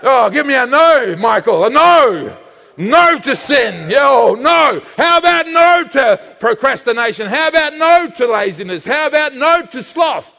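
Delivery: loud, high call to the crowd; rallying shout. Real, not acted. Preacher leading the church to shout no, loud and high. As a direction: rouse the congregation to shout no. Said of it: "Everybody say no".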